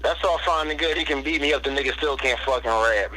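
ordinary delivery

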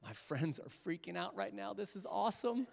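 hushed, playful inner voice; imagined gleeful thought. Retold or imitated. Jesus privately enjoying his friends' shock, hushed glee. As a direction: murmur it like a secret delight. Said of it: "as he's thinking".

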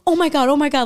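high, loud retold panic; mock panic. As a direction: relive the moment he came around the corner. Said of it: "I'm like".

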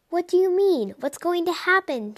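put-on high, anxious young cat; shaking voice. A narrator's reading. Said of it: "his voice shaking".

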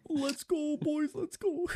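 high hyped chant; frat bro cheer. Acted.

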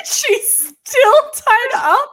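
laughing incredulous question; laughing disbelief. Real, not acted. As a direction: stretch it out in laughing shock.

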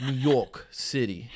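slow, hammed-up New York accent. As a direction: drag it out like a New Yorker. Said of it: "let me try it again".

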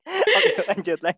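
laughing it off, high; words broken up by laughter. Real, not acted. Laughing at the Bandung joke.

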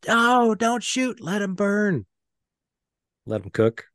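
high, slow mock soldier; hammed-up war movie voice; movie soldier quote. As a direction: drag it out like a movie line. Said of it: "He goes".

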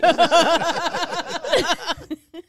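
long, high burst of laughter; high rolling laugh; real laughter. Real, not acted. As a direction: crack up and keep laughing. Teasing Maya, then bursts out laughing.

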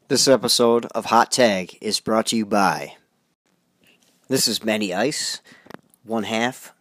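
loud, steady station-ID voice; announcer read. Acted. Host announcing the show and network, loud announcer read. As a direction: read it like a radio spot.

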